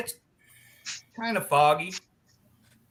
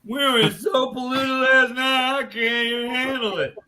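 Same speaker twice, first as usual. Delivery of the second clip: put-on hungover drunk; 6 semitones higher, 5 dB louder than usual. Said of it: "one of the".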